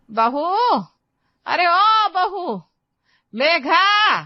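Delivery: long, high drawn-out call across the house; calling out loudly. Acted. Mother-in-law calling her daughter-in-law from afar, drawn-out loud call. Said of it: "गला फाड़ फाड़ कर आवाज लगा रही हूँ".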